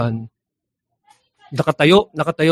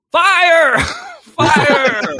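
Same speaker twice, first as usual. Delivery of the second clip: shout breaking into laughter; 13 semitones higher than usual. Retold or imitated. Erap at the firing range shouting fire, laughing. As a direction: shout fire while cracking up.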